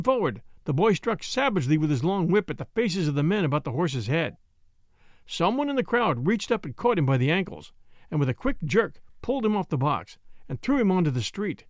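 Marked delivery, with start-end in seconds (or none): none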